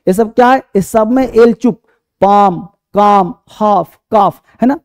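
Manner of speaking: ordinary talk, nothing marked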